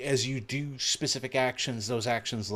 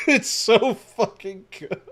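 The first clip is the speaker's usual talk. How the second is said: laughing; 14 semitones higher, 7 dB louder, slower than usual. Real, not acted. Gushing while laughing.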